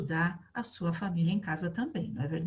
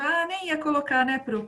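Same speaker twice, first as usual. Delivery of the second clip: higher-pitched voice, lightly playing a pupil; imitating students; 6 semitones higher than usual. Teacher voicing her pupils' reply, lightly imitated. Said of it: "já tô ouvindo vocês falando isso".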